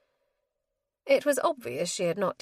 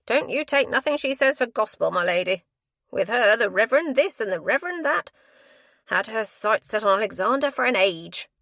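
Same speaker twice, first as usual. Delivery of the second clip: loud, coarse, scornful gossip; contemptuous matron; 4 semitones higher, 7 dB louder than usual. A narrator's reading. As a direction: run the spinster down with contempt. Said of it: "contemptuous glance".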